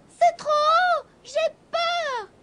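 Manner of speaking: high, small scared kitten voice; sobbing kitten. A narrator's reading. The kitten tells the witch it's too high, high and scared. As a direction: whimper it like a frightened little cat. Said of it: "se mit à sangloter Gobolino".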